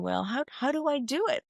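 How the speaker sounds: a hint of the questioning monkey mind. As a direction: lift the pitch, lightly acting out the mind. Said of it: "where the monkey mind starts to".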